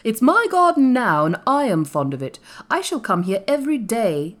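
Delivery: put-on firm boy's proclamation, starting loud; announcing. A narrator's reading. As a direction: announce it as master of the place. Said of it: "announced Colin".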